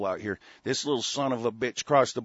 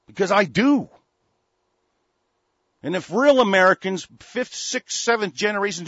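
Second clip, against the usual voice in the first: loud, higher, fired-up shout; loud angry rant burst; angry outburst; 9 semitones higher, 8 dB louder than usual. Real, not acted. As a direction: bark it out in real anger.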